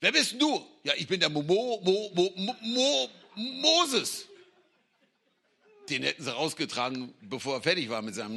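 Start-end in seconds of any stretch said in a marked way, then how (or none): 0.0-0.7 Pharaoh demanding. Retold or imitated. Pharaoh challenging Moses. 0.8-4.3 stuttering Moses. Retold or imitated. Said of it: "er hat gestottert".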